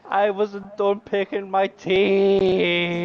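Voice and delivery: high, loud, stretched-out complaint; loud drawn-out protest. Real, not acted. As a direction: wail it in indignant protest. Loud protest at being hurried.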